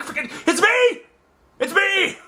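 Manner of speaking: high frantic it's me; high, flustered mock panic; panicked reveal. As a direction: scramble to explain.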